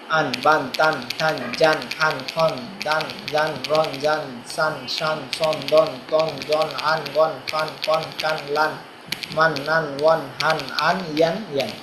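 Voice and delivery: evenly spaced, level-toned syllables; syllable drill recitation. Shown as a demonstration. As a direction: recite the syllables to a steady beat. (সমান তালে উচ্চারণ মশক)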